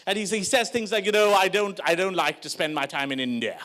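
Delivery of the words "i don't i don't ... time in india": put-on low, affected posh South Bombay accent; snooty Anglicised Indian accent. Posh friend complaining about India, very posh accent. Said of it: "he's very posh".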